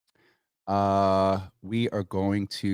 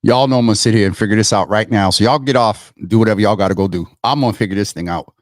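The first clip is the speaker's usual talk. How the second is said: louder, fast determined rattle; 2 semitones lower, 10 dB louder, faster than usual. Real, not acted. Streamer sending viewers off while she fixes it, loud and fast. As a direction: push through it loud and fast.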